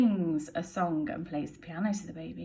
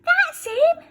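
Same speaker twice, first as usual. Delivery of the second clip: joyful Betty; 10 dB louder than usual. Acted. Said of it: "says Burglar Betty".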